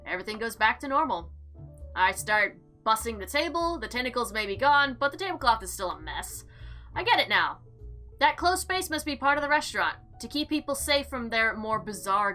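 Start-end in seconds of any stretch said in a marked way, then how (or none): none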